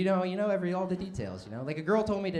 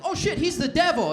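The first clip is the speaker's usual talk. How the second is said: put-on spooked teens; 10 dB louder than usual. Spooked teenagers.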